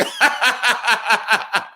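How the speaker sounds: high, bubbling laugh; real laugh. Real, not acted. Laughing in the middle of the song to the chat, high and delighted. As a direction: break into laughter.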